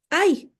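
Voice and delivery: a sharp, high cry of ay; exclamation ay. Shown as a demonstration. Teacher shows the class the ay sound, high exclamation. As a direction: let the ay burst out high as a model of the sound. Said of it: "Es un sonido".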